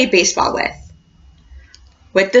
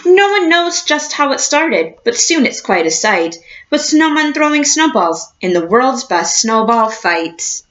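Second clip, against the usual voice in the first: put-on reading to children. A narrator's reading. Teacher reading a picture book to her class, storybook voice.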